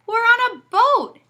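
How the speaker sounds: put-on high, loud kid protest. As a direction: squeal back at Dad. Jimmy protesting to Dad.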